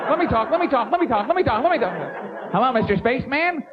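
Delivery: put-on high, childlike begging voice; Dan Quayle. Vice president begs to talk to astronauts, childishly eager. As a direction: pester like an excited little boy. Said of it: "Quayle's behind them".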